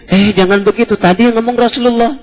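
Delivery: high-pitched passerby cautioning her; acted urgent warning. Orang lewat menegur wanita itu. (menirukan teguran orang lewat, nada tinggi)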